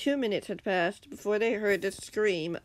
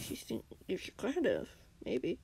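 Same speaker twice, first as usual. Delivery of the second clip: quiet; 7 dB quieter than usual. Acted. Hushed reply during ambush.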